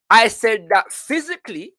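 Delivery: high, deliberate read-aloud; declaimed quotation. Acted. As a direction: pitch it up, reading the quote out to a class. Reads autobiography aloud.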